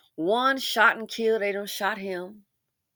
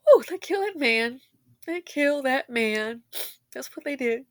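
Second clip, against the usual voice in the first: emotional; 6 semitones higher than usual. Real, not acted. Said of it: "sometimes I get emotional".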